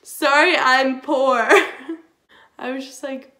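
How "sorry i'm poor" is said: louder, mock-apologetic snark; loud sarcastic sorry; sarcastic retort. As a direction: throw the apology back sarcastically.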